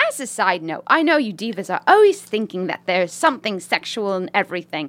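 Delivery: put-on British lady accent. Reading a listener's email as a British lady, British accent. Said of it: "British lady writing this email".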